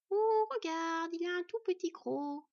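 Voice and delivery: high, slow, sneering monster voice; mocking spider. A narrator's reading. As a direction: drag out the taunt like a spider toying with prey. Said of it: "se moqua l'arachnide".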